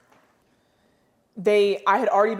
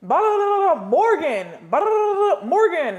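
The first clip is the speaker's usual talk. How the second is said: much higher, louder gibberish in a mock Spanish accent; mock Spanish coach; 11 semitones higher, 5 dB louder than usual. Player imitates her Spanish-speaking coach yelling, mock Spanish accent gibberish. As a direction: ham up a coach's rapid Spanish rant in a high voice. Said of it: "Yes, that's my Spanish voice".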